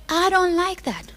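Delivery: a hint of a sneering villain; lightly voiced enemy sulking; enemy's voice. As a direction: put on a sly devil voice. Voicing the enemy.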